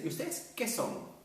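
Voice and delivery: high, teasing-style nosy question; prying question. Acted. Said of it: "le dices".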